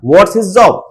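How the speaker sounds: "What's his job" run together; unstressed "his", said as iz; reduced, linked intonation. Shown as a demonstration. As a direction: glide through "What's his job" as one unit. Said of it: "उसको ज्यादा emphasise नहीं किया".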